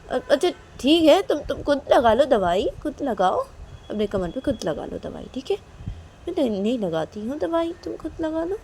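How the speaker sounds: fine, do it yourself then; huffy giving in. Acted. Kajal giving up on Reshma, huffy and exasperated.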